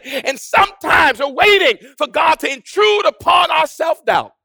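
loud, ringing sermon delivery; full-force preaching. Acted. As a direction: preach it in driving, emphatic phrases.

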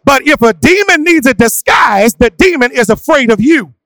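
loud, high, sung-out sermon cadence; preaching at full force. Acted. A pastor calling his church to face demons, fervent and loud. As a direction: drive it home with whooping fervour.

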